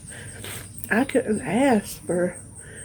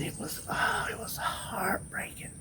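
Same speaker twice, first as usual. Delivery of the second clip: whispered grief; 10 dB quieter than usual. Real, not acted. Grieving a lonely mouse's death.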